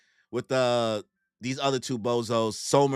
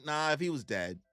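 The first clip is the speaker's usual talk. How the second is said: usual speaking voice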